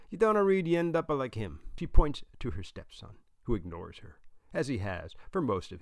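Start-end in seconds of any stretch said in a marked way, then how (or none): 0.1-1.6 put-on high, raised Italian-accented grandmother; Italian grandma's loud warning; thick Italian accent. A narrator's reading. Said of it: "in her thick accent".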